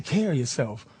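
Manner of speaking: low, soft imitated goodbye; older man's farewell. Old actor leaves the miserable comic, low imitated farewell. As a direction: drop low and soft as the elder.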